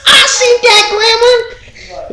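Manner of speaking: stretched out in a very high sung squeal, slow; mock excited lady. Retelling a neighbor lady who saw the gremlin, squealed high.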